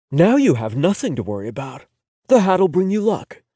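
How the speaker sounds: a hint of a tender boyfriend; lightly voiced reassuring boyfriend. A narrator's reading. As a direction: soothe him, promise luck. Owen reassures.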